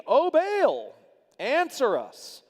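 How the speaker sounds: high, loud appeal, slowing on answer us; pleading cry. Acted. Prophets of Baal begging their god to answer, a loud high cry.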